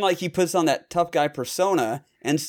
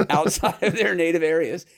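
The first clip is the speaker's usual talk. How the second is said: laughing; 3 semitones higher than usual. Real, not acted. Laughs at own dialect joke.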